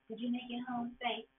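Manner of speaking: a hint of a smooth talker; cheesy line. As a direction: lay the cheesy line on lightly. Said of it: "a little cheesy line".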